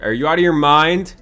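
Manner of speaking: loud fed-up rant at bad offers; angry outburst. Real, not acted. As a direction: yell at the screen in disbelief. Angry at trade demand.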